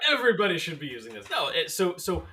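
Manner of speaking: laughing agreement. Real, not acted.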